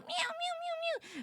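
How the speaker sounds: high, soft, slow meowing; kitten mews. Retold or imitated. Streamer imitating kittens climbing her legs. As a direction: mew like tiny kittens.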